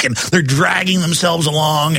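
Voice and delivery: normal talking voice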